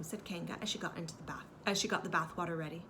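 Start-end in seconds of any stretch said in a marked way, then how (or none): none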